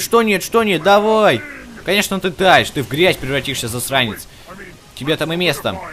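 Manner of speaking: usual speaking voice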